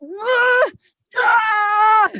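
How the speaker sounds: very high, loud transformation scream; anguished scream. Acted. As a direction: scream as your body transforms. Screams while transforming into truck.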